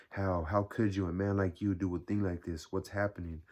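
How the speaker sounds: ordinary delivery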